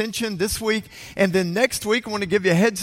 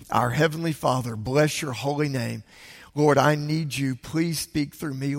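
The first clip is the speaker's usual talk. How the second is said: lower, softer, humble prayer; earnest prayer; 4 semitones lower, 3 dB quieter than usual. Real, not acted. Pastor praying for help before the sermon, lower and softer. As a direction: pray quietly and sincerely before preaching.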